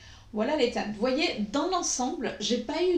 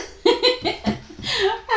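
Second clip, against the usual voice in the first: grand éclat de rire aigu et fort; 12 semitones higher, 7 dB louder than usual. Real, not acted. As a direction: rire de plaisir, haut perché. Rire ravi devant la palette. (éclat de rire ravi, très aigu)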